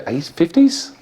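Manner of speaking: ordinary delivery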